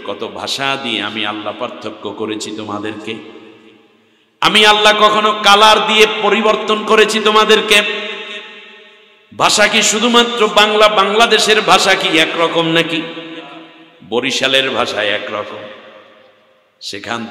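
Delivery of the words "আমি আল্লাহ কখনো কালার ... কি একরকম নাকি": higher, louder sermon call; preaching at full force. Acted. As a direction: call out the question to the crowd. Preacher rises again. (জোরালো ওয়াজের সুর)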